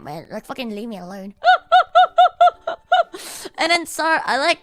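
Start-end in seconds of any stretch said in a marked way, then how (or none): 1.4-3.5 sudden high-pitched laugh bursting out; high burst of laughter. Real, not acted. Laughing as he recalls the ridiculous group-chat message. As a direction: crack up loudly at the absurdity.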